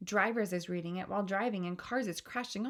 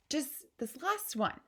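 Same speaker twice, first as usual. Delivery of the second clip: high, slow coaxing request; lightly wheedling little girl; pleading; 6 semitones higher, slower than usual. Acted. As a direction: coax gently for one more. Sophie begging to read more.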